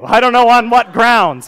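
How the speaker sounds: loud, high comic exasperation; mock outrage. Patient ranting about wanting to sue the dentist, mock outrage. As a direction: burst out in exasperated mock indignation.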